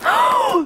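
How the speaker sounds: sharp high gasp of delight; excited gasp. Real, not acted. Thrilled gasp as she unwraps the gift.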